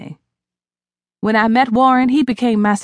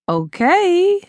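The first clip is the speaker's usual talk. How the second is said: expectant; 10 semitones higher than usual. A narrator's reading. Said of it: "I said expectantly".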